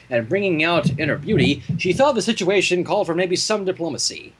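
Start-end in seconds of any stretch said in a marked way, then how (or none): none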